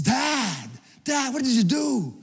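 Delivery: put-on high, loud exasperated kid scolding his dad; outraged son. Son scolding his father over Anthony Manta, loud and high. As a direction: cry out like a mortified ten-year-old.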